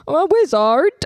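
put-on British accent. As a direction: play a wizard with a British accent.

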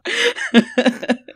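loud high laugh; high burst of laughter. Real, not acted.

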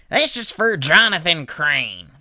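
slow, loud announcing lunch lady; mock lunch lady announcing whose food it is; lunch lady call. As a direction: call out the tray like a cafeteria lady.

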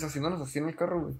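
ordinary talk, nothing marked

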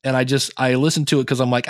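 normal talking voice

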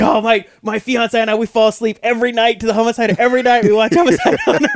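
fawning gush. Retold or imitated. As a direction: gush over the show like a giddy fan, laughing. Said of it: "he's just fawning".

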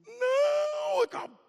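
very high, loud shriek; hammed-up frightened scream; panicked scream. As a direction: scream in terror. Bully screams refusal.

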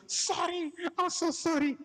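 high squeaky apologetic voice; flustered manager. Retold or imitated. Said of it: "He goes".

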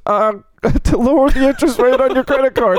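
struggling Indian call-center impersonation; Indian dialect. Retold or imitated. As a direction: retell the agent in a shaky attempt at an Indian accent. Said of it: "was that your Indian dialect voice?".

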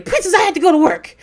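loud, high-pitched imitation of husband; hammed-up complaining yell; mock outcry. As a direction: wail it like a husband caught out. Retold husband's alarmed complaint.

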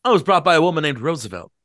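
put-on booming eager drunk; owl-eyed drunk. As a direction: show off the books to the guests. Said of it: "he continued".

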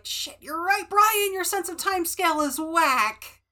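high, loud sudden realization; mock excited realization. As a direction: blurt it out like a revelation. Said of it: "And I'm like".

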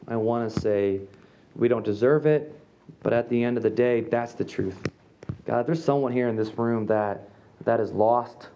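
earnest, slowing prayer voice to God; closing prayer. Acted. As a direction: pray it earnestly for the room.

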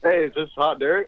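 phone speaker. Real, not acted. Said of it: "can you please take us off speakerphone".

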